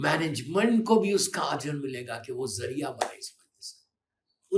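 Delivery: ordinary talk, nothing marked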